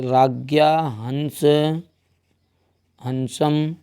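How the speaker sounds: slow, stretched syllables while writing; slow dictation. Real, not acted. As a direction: spell it out slowly for note-takers. Saying corrected word while writing it. (धीरे-धीरे लिखवाते हुए)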